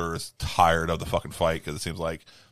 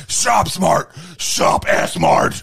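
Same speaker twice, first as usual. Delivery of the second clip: loud bellowed store slogan, slowing; hammed-up hero yelling a slogan, drawn out at the end; slogan shout; 11 semitones higher, 9 dB louder, much slower than usual. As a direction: belt it out like a bombastic pitch. Acts out Ash's sales slogan.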